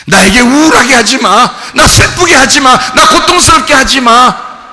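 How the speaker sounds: high, forceful acted warning; defiant protest. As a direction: shout down the tormentor. Acting out defiant prayer.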